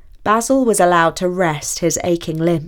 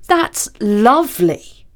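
sulky; 3 semitones higher, 4 dB louder, slower than usual. A narrator's reading. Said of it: "said Basil sulkily".

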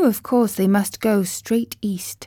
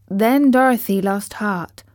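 plain reading voice

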